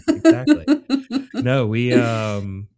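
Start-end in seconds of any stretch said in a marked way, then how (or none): 0.1-0.7 squeaky high laugh through the words. Real, not acted. Laughing agreement.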